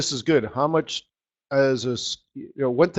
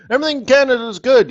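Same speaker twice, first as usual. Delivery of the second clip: mock cheerful; 12 semitones higher, 9 dB louder, faster than usual. Mocking Canadian optimism.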